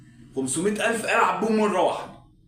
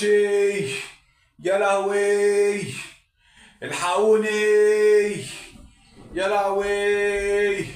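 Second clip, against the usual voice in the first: slow, drawn-out wail of ruin; wailing despair; 4 semitones higher, much slower than usual. Acted. Man who lost the house money, slow despairing wail. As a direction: wail it like everything is gone. Gambler realizes he lost everything.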